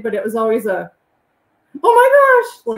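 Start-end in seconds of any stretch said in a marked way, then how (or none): 1.8-2.8 acted excitement. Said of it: "I would act excited".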